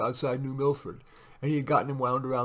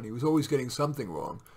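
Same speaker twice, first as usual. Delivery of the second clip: usual speaking voice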